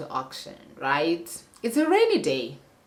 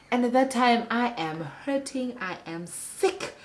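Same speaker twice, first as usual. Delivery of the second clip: ordinary talk, nothing marked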